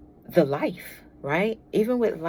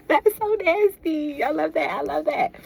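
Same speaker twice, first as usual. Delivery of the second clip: much higher, louder gleeful squeal; delighted squeal; 10 semitones higher, 6 dB louder than usual. Real, not acted. Tarot reader squealing at a spicy reading.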